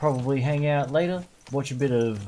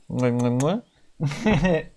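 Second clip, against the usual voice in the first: big wet smooch; 3 semitones higher, 4 dB louder, much slower than usual. Pretend. Smooching greeting to the chat.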